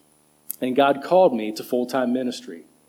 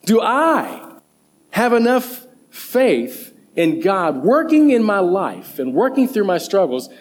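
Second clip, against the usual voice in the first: ordinary delivery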